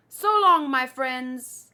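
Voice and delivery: loud, ringing goodbye; calling farewell. Acted. Sailor calling farewell to his friends, loud. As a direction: call it out across the water.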